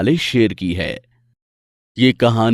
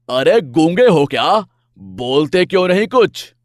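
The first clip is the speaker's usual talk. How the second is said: louder, higher furious lion snapping at elephant; angry roar; 4 semitones higher, 3 dB louder than usual. Acted. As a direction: roar it out, fed up. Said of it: "तेज दहाड़ कर बोलता है". (गुस्से में दहाड़ते शेर की ऊँची आवाज़)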